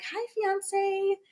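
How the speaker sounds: high, slow, sweet cooing voice; lovey-dovey greeting. Retold or imitated. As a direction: coo it to your new fiance. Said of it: "I'm like".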